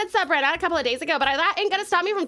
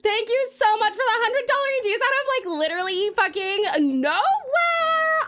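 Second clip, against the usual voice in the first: high-pitched, stretched-out real astonishment; stunned excitement; 5 semitones higher than usual. Real, not acted. Streamer reacting to a hundred dollar donation, stunned and excited. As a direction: gush in disbelief, pitched up high.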